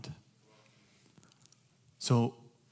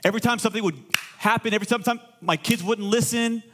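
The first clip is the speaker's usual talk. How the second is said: preaching; 7 semitones higher than usual. Real, not acted. Raised preaching.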